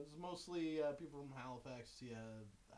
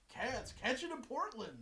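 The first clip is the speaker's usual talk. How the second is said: higher, louder ad voice; TV ad announcer; 9 semitones higher, 5 dB louder than usual. Retold or imitated. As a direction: pitch it like a TV promo.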